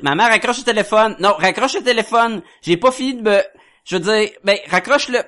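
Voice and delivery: voix d'enfant qui hurle plus aigu; criard d'enfant. Retold or imitated. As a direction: crier à maman de raccrocher, plus haut et fort. Enfant qui crie à sa mère. (voix d'ado qui crie, plus aiguë)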